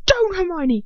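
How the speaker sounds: high, loud cry of warning; wild shout. A narrator's reading. Ron to Hermione as he is held down, shouting wildly. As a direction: shout it wildly, pitch falling through the name. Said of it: "shouting wildly".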